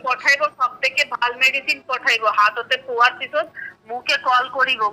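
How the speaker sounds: normal talking voice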